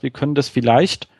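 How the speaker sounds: ordinary talk, nothing marked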